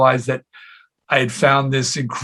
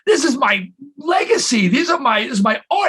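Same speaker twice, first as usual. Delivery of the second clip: hammed-up outraged shout, much higher; comic disbelief, shouting up high; mock outrage; 12 semitones higher than usual. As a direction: play up the indignant artist, yelling high. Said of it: "And I said".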